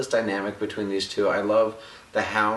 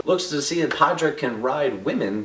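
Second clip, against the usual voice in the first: usual speaking voice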